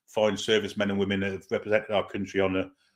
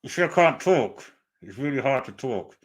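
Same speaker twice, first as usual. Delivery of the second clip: put-on makeshift German accent; 3 semitones higher, 3 dB louder than usual. Streamer wearing Klopp teeth for chat, makeshift German accent. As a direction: mouth full of fake teeth, a rough mock German accent. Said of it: "makeshift German accent".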